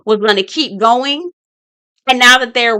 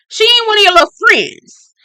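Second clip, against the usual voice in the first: much higher, louder indignant repeat; indignant scolding; 11 semitones higher, 5 dB louder than usual. Retold or imitated. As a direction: push it up, outraged on ain't. Scolding the boys for disrespect.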